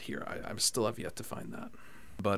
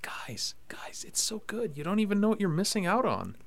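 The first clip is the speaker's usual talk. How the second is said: mock pleading; 11 semitones higher than usual. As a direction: plead lightly with a doubting family. Retold pleading with family.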